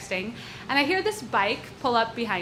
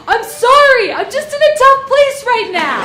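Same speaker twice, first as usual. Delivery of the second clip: much higher, much louder apologetic yell; 12 semitones higher, 12 dB louder than usual. Retold or imitated. Mugger calling from the hilltop, loud apologetic yell. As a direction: call it down a hill, sorry and desperate. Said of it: "yells down to me".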